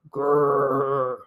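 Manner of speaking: a low growl in the throat; low bear growl. Acted. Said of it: "growled Bear".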